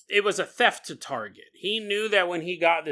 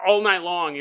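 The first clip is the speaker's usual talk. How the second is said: usual speaking voice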